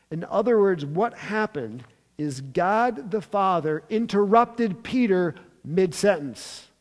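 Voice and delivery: normal talking voice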